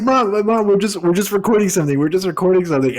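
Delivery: half-hammed panicked excuse; mock frantic explaining. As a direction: rush to calm mom down. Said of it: "And we're just like".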